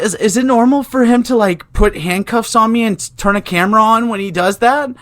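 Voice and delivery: exaggerated high-pitched victim act; mock complaint. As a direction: plead in a hammed-up high voice. Retold mock complaint to the nurses.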